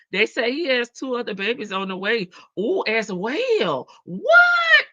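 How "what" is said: high, loud shocked shout; shocked what. Real, not acted. Host reacting to gossip with a shocked shout. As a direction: yell it out in disbelief. Shocked at news of more babies.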